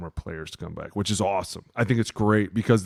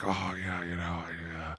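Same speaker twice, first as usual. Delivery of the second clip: much softer, sheepish backing down; mock sheepish reply; meek mumble; 3 semitones lower, 11 dB quieter, slower than usual. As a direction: mumble like a cowed coach. Coach meekly backing down.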